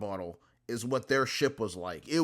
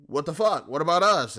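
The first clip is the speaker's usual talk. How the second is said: loud, higher indignant outburst; outraged reporters; 5 semitones higher, 8 dB louder than usual. Retold or imitated. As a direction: shout it like an offended crowd. Reporters outraged at press conference.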